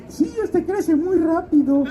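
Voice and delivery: slow, very high godmother voice. Retold or imitated. Godmother reassuring the puzzled actor, in a slow, high put-on voice. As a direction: stretch it out in a high-pitched caricature. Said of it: "¿Qué, madrina?".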